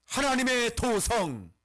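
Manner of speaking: ringing pulpit cry; forceful proclamation. Acted. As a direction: proclaim it solemnly at full force. Preacher proclaims at full force.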